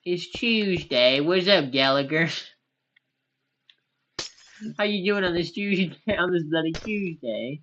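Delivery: hammed-up, badly faked British accent. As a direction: playfully attempt a British greeting accent. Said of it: "I'm so bad at, uh, doing any accent".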